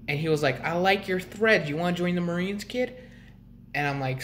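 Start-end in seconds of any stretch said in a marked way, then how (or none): 0.6-2.9 higher-pitched recruiter impression; imitated Marine recruiter. Marine recruiter approaching a kid in a hoodie, higher imitated voice. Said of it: "a Marine recruiter actually saw me".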